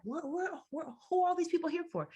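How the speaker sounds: high, rushed, incredulous; stunned disbelief. Retold or imitated. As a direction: blurt it out, unable to believe it. Shocked at the crowded room.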